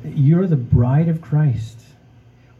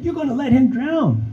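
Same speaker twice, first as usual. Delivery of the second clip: high, exasperated cry of disbelief; much higher, frustrated accusation; frustrated outburst; 12 semitones higher than usual. Retold or imitated. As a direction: throw it at him, incredulous and fed up. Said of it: "getting really frustrated and angry with this guy".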